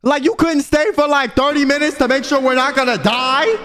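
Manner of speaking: high, incredulous outburst; outraged rhetorical question; indignant disbelief. Retold or imitated. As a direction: protest at being abandoned, pitch way up. Indignant at man who left them.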